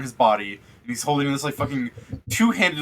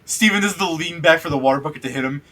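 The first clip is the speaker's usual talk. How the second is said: ordinary talk, nothing marked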